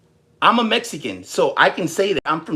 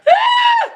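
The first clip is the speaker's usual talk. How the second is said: piercing high battle yell; fight scream; 7 dB louder than usual. Shown as a demonstration. Streamer showing how he screams in fights, high shriek. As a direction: let out a shrill fight shriek. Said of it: "I sound like".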